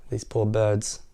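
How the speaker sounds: plain reading voice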